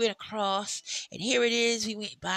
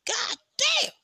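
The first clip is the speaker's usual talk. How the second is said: exasperated exclaim; 5 dB louder than usual. Real, not acted. Complaining about heat.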